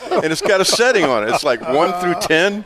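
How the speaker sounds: high, amused telling; can't stop laughing as he tells it; laughing through the words; laughing while talking. Real, not acted. Laughing about the setting.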